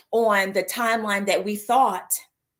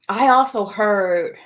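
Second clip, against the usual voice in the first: ordinary delivery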